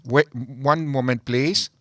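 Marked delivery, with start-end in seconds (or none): none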